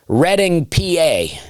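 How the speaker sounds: "Reading, P A" high, as RED-ing pee-ay; cool local lingo. Real, not acted. Comedian showing off local lingo, "Reading, P A" as high RED-ing pee-ay. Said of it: "That's how you sound cool".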